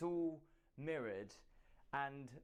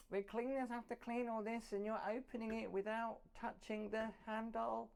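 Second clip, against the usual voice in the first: high, whiny mock complainer; hammed-up nagging critic voice; moaning complainer; 9 semitones higher than usual. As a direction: mimic a fussy guest griping, much higher. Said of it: "I know what some people might moan, going".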